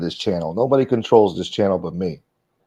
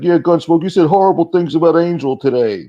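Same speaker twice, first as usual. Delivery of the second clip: higher, louder sneering mimic of a chat troll; mocking troll voice; 8 semitones higher, 4 dB louder than usual. Streamer mimicking a troll from chat, sneering. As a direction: parrot the accuser in a whiny put-on voice. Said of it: "talking shit".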